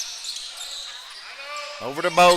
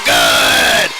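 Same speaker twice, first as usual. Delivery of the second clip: a louder shout as the shot drops; excited shout; 2 semitones lower, 6 dB louder than usual. Real, not acted. Three-pointer falls, play-by-play shout.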